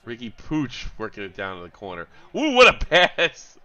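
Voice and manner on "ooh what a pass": loud, high, drawn-out cheer; real thrilled reaction to a play; excited commentary. Real, not acted. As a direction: stretch it out in loud admiration.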